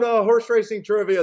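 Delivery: usual speaking voice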